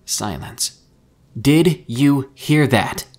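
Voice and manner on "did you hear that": slow, loud, word-by-word shout; screamed into radio. A narrator's reading. Mika screams into the silent radio, nearly in a rage. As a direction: yell each word separately, higher and enraged. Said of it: "Mika screamed into the radio".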